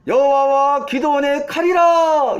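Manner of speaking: high, drawn-out rallying shout; imitated battle cry. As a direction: cry it out long and high, as if leading troops. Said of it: "이렇게 똑같이 외치는 거야". (길고 높게 뽑아 외치는 함성 흉내)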